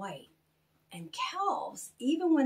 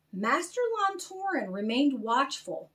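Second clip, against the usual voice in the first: ordinary delivery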